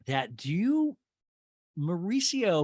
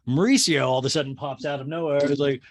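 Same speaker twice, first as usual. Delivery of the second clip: ordinary delivery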